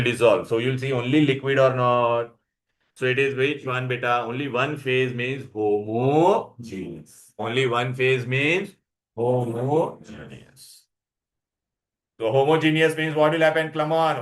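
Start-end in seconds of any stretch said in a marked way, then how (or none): 4.2-10.9 slow emphatic definition. Shown as a demonstration. Teacher repeats definition slowly.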